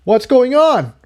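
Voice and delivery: mild mock shout. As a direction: call out to find out what's happening. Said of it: "Jigsaw shouted".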